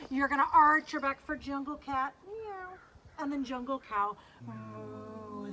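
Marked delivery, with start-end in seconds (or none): 2.3-2.8 high, soft little meow; cat meow. Pretend. Acting a jungle cat. 4.5-5.5 soft, low, held moo; low gently like a cow. Pretend. Acting a jungle cow.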